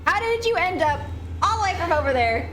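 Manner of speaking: high-pitched, indignant outburst; exasperated yelling. Real, not acted. Streamer berates creature that led her astray, exasperated yelling. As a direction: demand answers in a high, exasperated cry.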